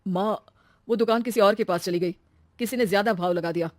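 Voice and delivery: understated gloom in a male voice; dejected son. Acted. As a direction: break bad news glumly to mother. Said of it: "बहुत दुखी होकर घर आता है". (दुखी, बुझा हुआ बेटा)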